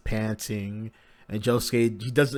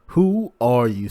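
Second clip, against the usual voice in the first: a loud, drawn-out demand; 8 dB louder, slower than usual. A narrator's reading. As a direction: bark the question at her. Josuke demands her identity.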